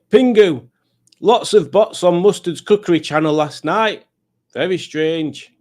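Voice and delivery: high, loud toy voice; Bad Books toy chatting to Pingu; puppet voice. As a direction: give the toy a squeaky, animated voice. Voicing Bad Books toy reading message.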